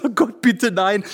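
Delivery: loud pleading groan; mock despair. Apprentice begging God, mock despairing. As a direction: beg off, half-hammed. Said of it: "Ich hatte gesagt".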